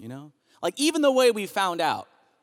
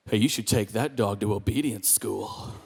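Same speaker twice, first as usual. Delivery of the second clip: low, softer condescending neighbor; 9 semitones lower, 5 dB quieter than usual. Retold or imitated. Neighbor advising on his dog, condescending. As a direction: talk down in a lower, measured voice. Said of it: "in a real condescending voice".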